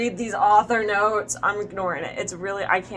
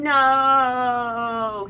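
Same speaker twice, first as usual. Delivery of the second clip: long drawn-out, raised "no"; mock scream; 5 semitones higher, 5 dB louder than usual. A narrator's reading. Stabbed villain screams as he falls, drawn-out mock scream. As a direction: wail it out loud and long. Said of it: "he screamed".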